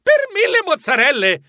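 high, astonished exclamation; flabbergasted, pitch leaping up; stammering cry. A narrator's reading. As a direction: cry out in disbelief, starting with a stammer. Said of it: "Io gridai".

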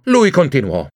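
ordinary delivery

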